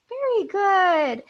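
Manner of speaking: put-on a sing-song Very good for the kids; big praise. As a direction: cheer them on like a game show host. Praising children.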